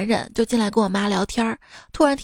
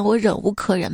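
usual speaking voice

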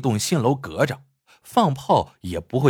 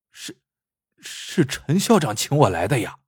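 a mild hesitant stammer. A narrator's reading. Seller stammers his answer to the angry man, hesitant. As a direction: falter on the first word, unsure. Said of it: "结结巴巴地说".

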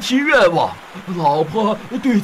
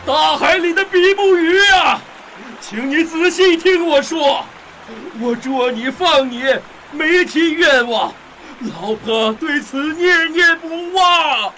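shouting over the storm; 7 semitones higher than usual. Acted. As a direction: bellow it over the wind. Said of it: "渔夫用力地喊".

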